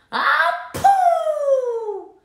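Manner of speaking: very high, loud sneezing yelp; fish sneeze. A narrator's reading. Bitten fish sneezing the snake away. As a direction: blast it out as a sneeze.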